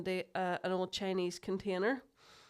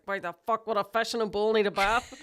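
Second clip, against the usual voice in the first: mock outrage; 8 dB louder than usual. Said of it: "I was like".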